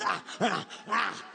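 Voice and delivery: low, short "ah" licking noises; animal-like slurping noises; imitated licking. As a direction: mime lapping with the tongue out. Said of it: "He starts licking the sugar".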